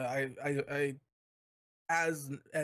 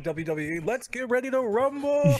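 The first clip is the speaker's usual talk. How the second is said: announcer yell, pitched way up on rumble; wrestling announcer; 9 semitones higher, 6 dB louder than usual. Retold or imitated. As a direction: belt it like a ring announcer. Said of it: "She's like".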